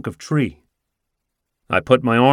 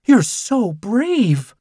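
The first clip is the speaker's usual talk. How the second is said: much higher, very slow admiring line; swooning; 11 semitones higher, much slower than usual. Acted. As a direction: swoon over the detective. Flirting owner.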